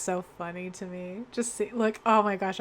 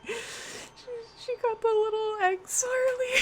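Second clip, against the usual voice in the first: laughing; 3 dB louder than usual. Real, not acted. Still laughing at the image.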